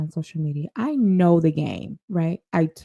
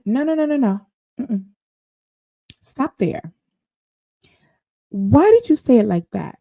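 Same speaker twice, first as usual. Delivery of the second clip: retold interruption; 3 semitones higher, 4 dB louder than usual. Acts out interrupting his story.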